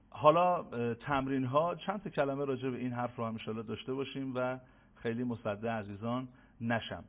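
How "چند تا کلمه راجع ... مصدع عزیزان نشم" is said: ordinary talk, nothing marked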